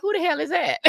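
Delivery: high mock bewildered voice. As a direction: pitch up, mock confused star. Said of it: "she's looking at me like".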